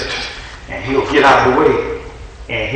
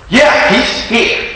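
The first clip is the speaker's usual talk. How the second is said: high, slow and drawn out; wife presenting her husband, high and slow; wife's voice; 10 semitones higher, much slower than usual. Retold or imitated. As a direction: stretch it out, pleased with herself. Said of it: "and she said".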